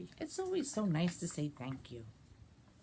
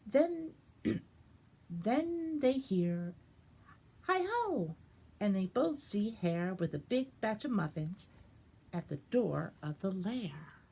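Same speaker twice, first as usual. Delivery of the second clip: put-on lively read-aloud for children; animated picture-book reading; story narration; 2 semitones higher, 5 dB louder than usual. A narrator's reading. Librarian reads picture book.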